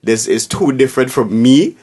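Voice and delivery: ordinary delivery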